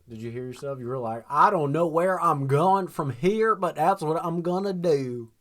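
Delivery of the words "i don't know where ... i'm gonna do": slow, mock Southern drawl imitating a friend; mock Southern accent. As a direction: tease him by copying his Southern accent. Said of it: "turned your Australian accent into a Southern accent".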